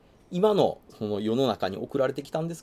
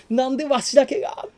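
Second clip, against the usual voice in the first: put-on much higher, louder plaintive old-man voice; whining old man; 13 semitones higher, 6 dB louder than usual. Bitten old man complaining to everyone, high-pitched and loud. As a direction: wail like an abandoned old man.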